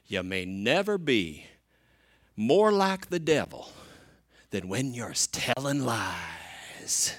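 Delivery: drawn-out Southern preacher drawl; slow Southern preacher, stretching the last words out long; Southern accent. Acted. Quoting an old preacher to the congregation, Southern accent. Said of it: "gonna try my best Southern accent".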